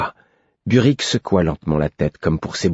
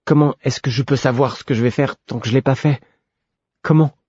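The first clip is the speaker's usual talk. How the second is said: higher, desperate questioning; protesting; 7 semitones higher than usual. A narrator's reading. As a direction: demand an answer, voice climbing. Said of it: "protestai-je". (protestation désespérée)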